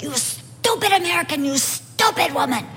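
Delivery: mock angry girls. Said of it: "they start screaming at me".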